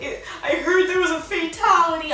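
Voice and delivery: high-pitched mock panic; panicked mom. Mom tells daughter she heard of a death, mock panicked. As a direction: imitate a distraught mom. Mom fears someone died.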